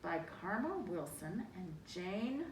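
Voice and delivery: ordinary delivery, nothing marked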